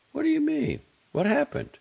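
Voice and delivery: very high, sharp questioning. A narrator's reading. Alarmed guest questioning matron of honor, sharply. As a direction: demand an explanation sharply, pitched right up. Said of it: "Missus Silsburn said sharply".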